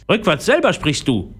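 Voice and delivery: high parrot retort; scolding parrot. A narrator's reading. Parrot retorts to Philipp, scolding high voice. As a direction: throw it back in a squawky bird voice. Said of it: "schimpfte der Papagei".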